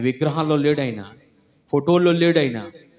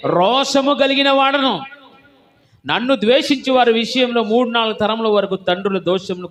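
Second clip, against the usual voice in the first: forceful scripture proclamation; 9 semitones higher, 6 dB louder than usual. Acted. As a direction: proclaim God's words like a verdict. Reads God's words with force.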